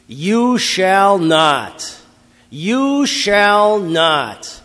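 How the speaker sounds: slow, loud, high-pitched furious shouts; angry screamed commands. Acted. Slave master screaming commands at the slave in anger, furious drawn-out shout. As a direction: scream each command, dragging out the words. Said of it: "He screams his commands at you with anger in his voice".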